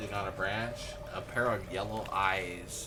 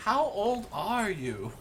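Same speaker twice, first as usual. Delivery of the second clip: put-on high, slow, loud kid question; dwarf boy; 11 semitones higher, 5 dB louder, slower than usual. Bobby questions the stranger's age, high and slow. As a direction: ask slowly in a high child's voice. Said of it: "Bobby says".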